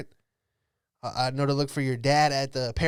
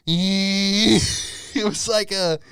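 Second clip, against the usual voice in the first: words squeezed out through laughter; laughing; 6 semitones higher, 6 dB louder, much slower than usual. Real, not acted. Telling the chaperone story, breaking into laughter. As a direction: laugh through it, high and slow.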